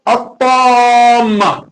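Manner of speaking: high, drawn-out "at-tamma" with a heavy open TAA; heavy tafkhim. Shown as a demonstration. Teacher modelling the heavy letter, tafkhim. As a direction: recite "at-tamma" with a long full alif. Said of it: "at-tamma is harfun mufakham".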